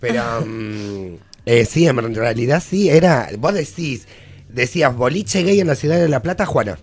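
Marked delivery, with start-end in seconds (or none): none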